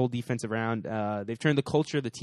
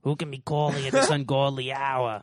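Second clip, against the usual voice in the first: lightly voiced shrill nagging mother; a hint of a fussy mom; Howard's mom voice. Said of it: "Kinda sound like Howard's mom".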